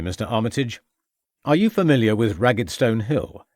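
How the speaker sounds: ordinary delivery, nothing marked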